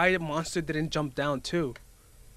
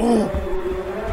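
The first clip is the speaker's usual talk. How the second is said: startled cry; 5 semitones higher, 4 dB louder than usual. Real, not acted. Another scare in video.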